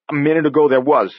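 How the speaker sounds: insistent fear. Acted. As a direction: hold your ground, scared. Andy insists, still pale.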